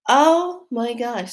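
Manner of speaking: high gasp, then slow amazed oh my gosh; gasp then awe. Retold or imitated. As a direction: catch your breath in wonder. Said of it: "I was like".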